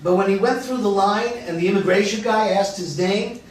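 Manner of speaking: usual speaking voice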